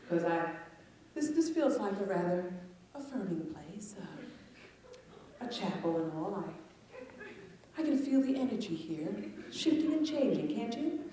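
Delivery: soft, hushed new-age murmur; mock serene guru. Comic leading the crowd in mock meditation, softly. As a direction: play a gentle spiritual guide, softly.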